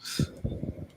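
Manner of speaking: breathy whispered echo. Acted. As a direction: whisper the word to yourself.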